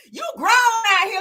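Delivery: slow, emphatic scolding; scolding in character. Pretend. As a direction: scold him like a fed-up auntie. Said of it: "Let me get back into character".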